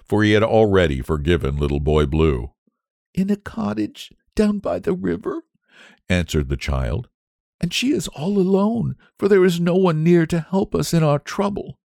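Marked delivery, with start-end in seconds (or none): 3.1-5.4 put-on high, small child voice; sad little boy. A narrator's reading. Tearful child answers squire. 7.6-11.8 put-on high, plaintive child voice; sad little boy. A narrator's reading. Tearful child describes their trouble.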